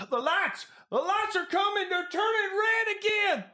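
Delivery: very high, frantic cry; panicked warning. Acted. Skinny stranger bursting in, panicked and very high. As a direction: burst in terrified of the lights.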